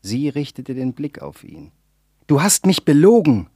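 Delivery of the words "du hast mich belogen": higher, louder furious accusation; angry cry. A narrator's reading. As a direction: call it out in rage. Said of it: "rief er".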